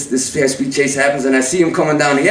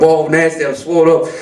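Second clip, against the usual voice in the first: ordinary delivery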